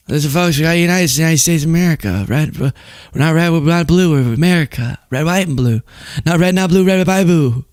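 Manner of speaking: slurred, rambling politician parody; Biden. Retold or imitated. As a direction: stumble through a folksy patriotic speech. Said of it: "you'd have Biden being like".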